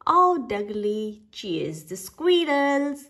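plain reading voice

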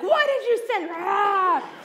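playful dinosaur roar; hammed-up dinosaur. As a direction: roar the question like a T. rex with a laugh. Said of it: "I will never do a dinosaur impression".

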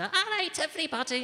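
high-pitched game show hostess. Acted. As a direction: greet the studio in a high hostess voice. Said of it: "you'd have Scylla come out".

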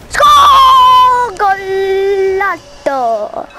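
high, loud stretched scream of score; goal celebration shout. Real, not acted. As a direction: roar the goal like a commentator.

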